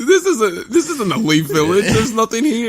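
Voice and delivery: high, laughing baffled voice; imitated baffled kid, amused; mock bewilderment. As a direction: exclaim it in high-pitched confusion. Voicing confused returning Naruto.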